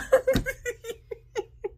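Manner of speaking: high laugh. Real, not acted. Thanking Jesus for marriage prep, a high giddy laugh.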